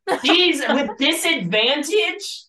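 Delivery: loud, high astonished gasp; amazed. Real, not acted. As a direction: react in disbelief at the roll. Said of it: "reacting to roll".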